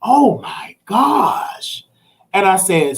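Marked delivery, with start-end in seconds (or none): 0.0-1.8 dismayed lawyer. Retold or imitated. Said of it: "he said".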